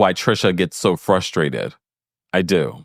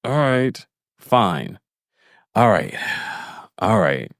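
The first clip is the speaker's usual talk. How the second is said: tight-lipped; 5 semitones higher, slower than usual. Retold or imitated. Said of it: "Tight-lipped teeth".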